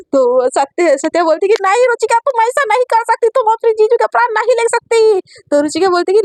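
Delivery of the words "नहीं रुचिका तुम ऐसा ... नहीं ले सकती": high, pleading man begging for his life; frightened pleading. Acted. Satya begs his sister-in-law to spare him, high frightened plea. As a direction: beg her, voice pitched way up in fear.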